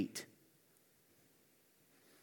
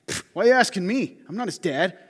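louder, higher, dismissive scoff; audacious Cain; 3 semitones higher, 7 dB louder than usual. Retold or imitated. Preacher mocks Cain brushing off God, with a loud scoff. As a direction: scoff it off like he couldn't care less. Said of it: "Imagine the audacity".

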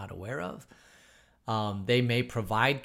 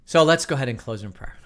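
ordinary talk, nothing marked

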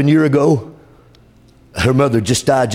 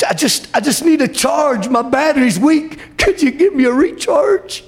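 pleading prayer; 10 semitones higher than usual. Retold or imitated. A weak believer crying to the Lord, high and pleading. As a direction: beg God with rising urgency.

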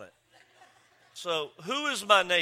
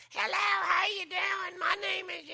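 high, drawn-out mock woman's voice; neighbor woman's voice; 13 semitones higher than usual. Preacher imitating a neighbor introducing herself, high mock voice. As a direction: impersonate the neighbor in a piercingly high, slow voice. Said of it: "the woman had this voice".

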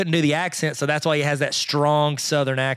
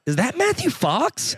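high, slow incredulous question; mock disbelief, pitched up and drawn out; puzzled recognition; 9 semitones higher, slower than usual. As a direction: act out a double take at a familiar face. Acts out viewer spotting an actor.

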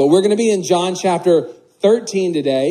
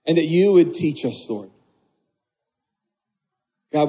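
prayer. Acted. Leads the congregation in prayer.

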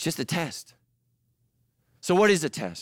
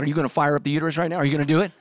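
rapid teasing; 4 dB louder, much faster than usual. Retold or imitated. Teasing God about fired-up uterus.

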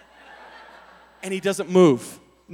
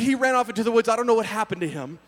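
ordinary talk

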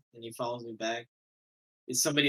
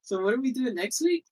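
laughing high voice; 11 semitones higher, 5 dB louder than usual. Real, not acted. Squeaky laughing voice.

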